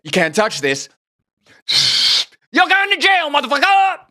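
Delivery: shifted voice, a mocking faux-quote building to a yell. Host fake-quoting Shaw to mock fake quotes, rising to a mock yell. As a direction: fake a pompous quote, then snap into a yell. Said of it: "George Bernard Shaw that said".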